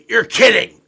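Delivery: loud, high, delighted and laughing; a thrilled buddy hearing he won; excited disbelief. Retold or imitated. Buddy hearing he won the raffle, thrilled and laughing. Friend thrilled on phone call.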